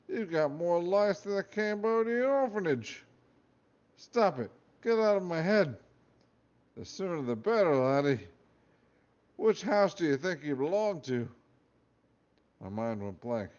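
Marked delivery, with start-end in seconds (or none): 0.1-3.0 Scottish hat voice. Acted. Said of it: "Scottish accented voice". 4.0-5.8 high, loud desperate plea; panicked pleading. Acted. Boy shouting at the hat in his head, high panicked plea. 6.8-11.3 Scottish hat voice. Acted. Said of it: "Scottish accented voice".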